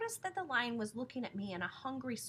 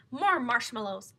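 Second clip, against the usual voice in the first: louder, slow demand; 3 semitones higher, 10 dB louder, slower than usual. A narrator's reading. Lion demanding more from the waiter. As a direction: insist on more.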